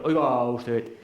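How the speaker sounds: a brief mock "Oiga usted" telling-off; a hint of the annoyed actor; imitating a scolding. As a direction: toss off the scold lightly. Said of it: "No está en la reacción".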